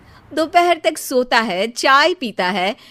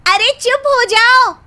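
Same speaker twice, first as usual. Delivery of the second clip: loud, high snap to silence him; fed-up wife yelling over the husband's poetry; shouting shut up; 9 semitones higher, 6 dB louder than usual. Acted. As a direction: cut him off with a sharp yell. Wife cuts off his shayari.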